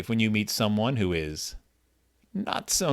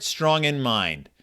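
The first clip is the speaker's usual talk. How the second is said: slow slogan; 3 dB quieter, much slower than usual. Shown as a demonstration. Repeats the slogan slowly.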